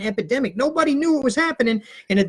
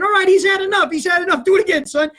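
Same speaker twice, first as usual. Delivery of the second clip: louder, higher amused dad; laughing dad calls out; 7 semitones higher, 6 dB louder than usual. Retold or imitated. Dad cheers son from front seat, loud. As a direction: egg the kid on, loudly. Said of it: "Dad's laughing".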